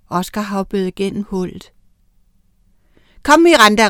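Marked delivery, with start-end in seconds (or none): 3.2-3.9 raised, louder call to a companion; moderately shouted summons; urgent shout. A narrator's reading. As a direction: call out to hurry someone along. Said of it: "råbte han".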